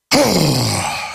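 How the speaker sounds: long exasperated exhale; drawn-out sigh; heavy sigh. Real, not acted. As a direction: let out a weary sigh. Exasperated after describing government.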